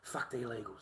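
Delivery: breathy hushed whisper of the same words. Real, not acted. Speaker to viewers, whispering the slogan again. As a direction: drop to a bare whisper as if it's a secret.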